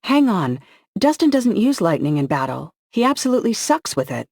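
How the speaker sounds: urgent realization, pitch climbing high; sudden alarm. A narrator's reading. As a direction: voice jumps up, realizing.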